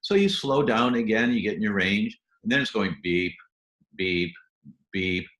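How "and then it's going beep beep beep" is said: a few lazy beeps, lower; spaced-out alarm beeps. Retold or imitated. Slow beeping alarm.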